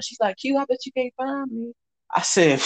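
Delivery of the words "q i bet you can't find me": higher, lightly voiced taunt; teasing woman's call. Woman hiding between carts teases him, playful high taunt. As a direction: mimic her playful dare.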